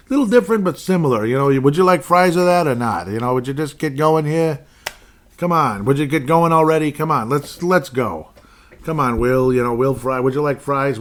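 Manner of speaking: put-on loud, high New York accent, pushy; a brash New York voice, hurrying someone along. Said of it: "I like to play with my voice a little bit".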